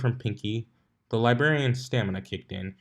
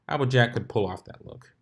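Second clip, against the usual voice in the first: ordinary delivery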